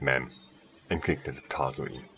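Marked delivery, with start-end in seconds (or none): none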